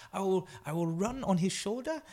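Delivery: high, halting put-on runner's voice; playful high-pitched mimicry; Emil Zatopek. Acting out Emil's simple race plan.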